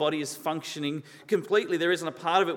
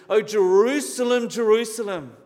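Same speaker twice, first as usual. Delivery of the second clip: raised, louder lament without tears; crying out in lament; 5 semitones higher, 7 dB louder than usual. Retold or imitated. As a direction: cry out over a city, higher and louder, imitating. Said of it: "when he was crying over Jerusalem".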